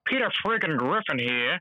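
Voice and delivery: high-pitched cartoon character voice; Peter Griffin. Shown as a demonstration. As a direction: announce yourself in a high, goofy cartoon impression. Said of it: "I do Peter, uh, Peter Griff".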